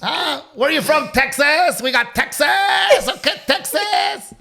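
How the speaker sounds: high, loud hype-man shouting with a Mexican flavour; rowdy Mexican MC yell; Mexican party host. Retold or imitated. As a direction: work the crowd like a Mexican host. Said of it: "there's a Mexican host".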